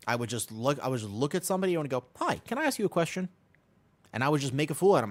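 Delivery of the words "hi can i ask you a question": higher, sing-song hello; mock-cheerful opener; bright friendly greeting. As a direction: chirp it at a stranger. Greeting a stranger.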